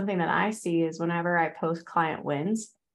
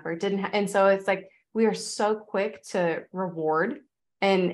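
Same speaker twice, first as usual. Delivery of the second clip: usual speaking voice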